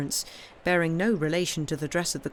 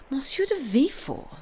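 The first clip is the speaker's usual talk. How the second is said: high startled cry; the name hits him like a blow; 9 semitones higher, 3 dB louder than usual. A narrator's reading. King crying out at the messenger's name, startled. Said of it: "cried the king".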